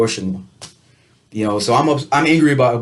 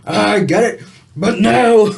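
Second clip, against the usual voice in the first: high, pushed-up mock protest; squeaky exaggerated no; mock refusal; 9 semitones higher, 5 dB louder than usual. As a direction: toss it off high and loud in mock objection. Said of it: "Not angry was like".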